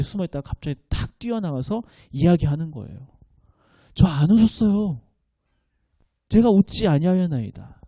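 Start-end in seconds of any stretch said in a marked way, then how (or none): 3.9-7.8 startled denial. Pretend. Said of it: "너무나도 깜짝 놀라고 두려운 마음에".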